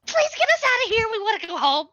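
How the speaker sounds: kobold voice. Acted. Kobold begging to be freed.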